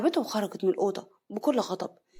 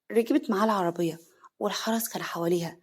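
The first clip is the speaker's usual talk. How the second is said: plain reading voice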